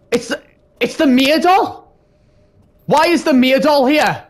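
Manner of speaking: real panic at the doll; terrified. Real, not acted. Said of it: "I'm scared".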